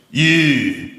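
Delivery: put-on very slow, low old drinker's growl; old pub regular sneering at an English lad; gruff old man. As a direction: drag it out low like a grim old Glaswegian. Old pub guy insults English.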